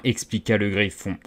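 usual speaking voice

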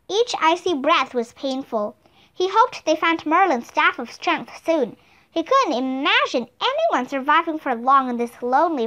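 plain reading voice

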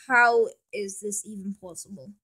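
usual speaking voice

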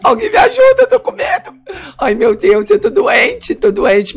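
high, loud frightened wailing; tearful panic. Acted. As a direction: cry for help in hammed-up sobs. Chora de medo sozinho, pede ajuda. (choro agudo de pânico)

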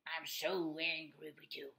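slow, low play-angry voice; mock anger. Shown as a demonstration. Explaining a joke to pupils, slow mock-angry growl. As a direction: put on anger for fun, low and drawn out. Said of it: "This is for fun".

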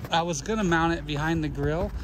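normal talking voice

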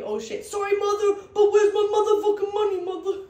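high, loud gangster voice with a laugh; mock gangster, laughing at herself; gangster apologizing. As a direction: catch yourself with mom, then demand the money. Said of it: "such a gangster on the phone".

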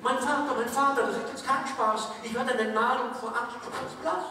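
put-on high, pleading child-like tree voice; mock-dramatic Erlking child; frightened fir tree.

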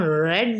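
rolled Scottish R opening red; trilled R in red. Shown as a demonstration. Teacher showing students a trill, rolled R in red. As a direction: let the tongue strike repeatedly on red's R. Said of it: "ये दो तीन दफ़ा जो ज़ुबान है".

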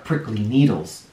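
ordinary delivery

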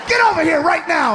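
high mock-furious shout; pissed wife. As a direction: yell the order in one angry rush. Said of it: "she looks pissed".

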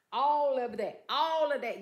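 loud, high all of that; emphatic exclaim. Real, not acted. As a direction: belt it with pride.

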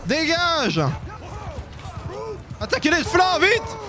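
high, urgent battle commands; shouting orders. Pretend. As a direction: bark orders at the troops. Yelling orders in battle game.

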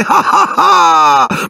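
high, hollow mock laughter; bitter ghost laugh. Ghost girl mocking the boys with a high bitter fake laugh. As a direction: cackle bitterly, without joy. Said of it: "strillò".

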